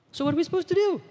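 very high, squeaky question; mock bafflement. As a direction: throw up a helpless, put-on wail. Mock bafflement at an unknown word.

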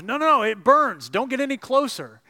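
high, loud, pained panic; panicked cry. Acted. Ghost man begs the burning angel away, panicked cry. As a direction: shriek it like it scorches.

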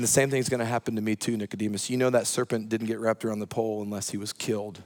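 quiet, intimate, earnest confiding; hushed earnest voice of Jesus. Retold or imitated. Jesus quietly confiding to Nicodemus, hushed and earnest. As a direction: lean in and tell him gently, close and low.

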